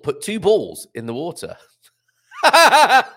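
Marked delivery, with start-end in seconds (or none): 2.4-3.0 loud, high cackle breaking into an apology; helpless laugh at the story; real burst of laughter. Real, not acted. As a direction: crack up mid-read, then say sorry. Cracks up reading the story.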